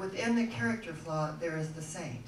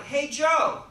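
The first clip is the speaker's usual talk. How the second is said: higher and louder, calling across to a colleague; 8 semitones higher, 10 dB louder than usual. Retold or imitated. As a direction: shout the name to get his attention. Policeman calls another officer.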